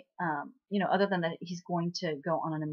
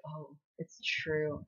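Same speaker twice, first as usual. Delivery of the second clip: slow, softer, deflated retelling; mock dejected; 3 semitones lower, 7 dB quieter, slower than usual. Girl giving up on being liked, mildly acted dejection. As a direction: sigh it out slowly as if let down. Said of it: "And she's totally depressed".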